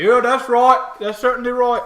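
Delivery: high, loud orc agreement; laughing orcs. Acted. Orcs at the far end agreeing, rowdy. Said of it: "they, they laugh".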